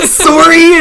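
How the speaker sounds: loud, laughing Canadian "sorry"; playful Canadian accent with a laugh. Acted. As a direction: exclaim it in a mock Canadian accent. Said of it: "like, a Canadian accent".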